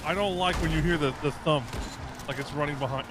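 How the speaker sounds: loud, tense fearful voice; scared as something closes in; being chased. Real, not acted. Chased by monster in game.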